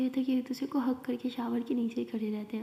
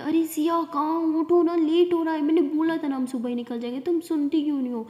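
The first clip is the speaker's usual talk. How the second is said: loud, higher calling through a locked door; mother shouting; 4 semitones higher, 7 dB louder than usual. A narrator's reading. Mother shouts at Siya through the door, loud and high. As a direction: yell for her daughter to wake up. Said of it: "ज़ोर से चिल्ला के बोलती है".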